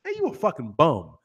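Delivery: casual, low American street delivery; laid-back American trash talk; American street insult. Shown as a demonstration. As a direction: toss off the insult in plain American slang. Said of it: "just compare the two".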